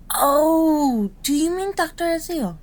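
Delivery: light up as it clicks, pitched high; sudden realisation. Acted. Realises who is meant.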